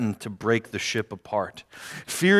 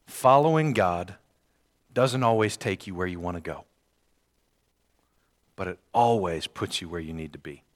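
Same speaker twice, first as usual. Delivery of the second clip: softer, low first take of the key phrase; quiet statement; 6 semitones lower, 5 dB quieter than usual. Shown as a demonstration. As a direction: lay the phrase down softly.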